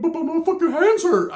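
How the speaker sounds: high-pitched mimicked whine; mock whining. Ricky whines to his cousin after the fight, high mock whining. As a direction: complain like a big baby, voice way up.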